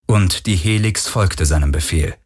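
ordinary delivery

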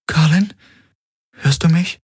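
high, searching call; concerned question. A narrator's reading. Kirai asks the waking patient if he hears her, concerned. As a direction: ask carefully, pitch lifted.

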